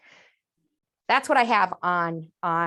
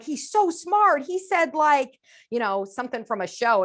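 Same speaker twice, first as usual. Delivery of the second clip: impressed gushing; 5 semitones higher, 4 dB louder than usual. Retold or imitated. Onlookers praising the child's phrase, gushing. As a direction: gush like a proud onlooker. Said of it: "people are gonna be like".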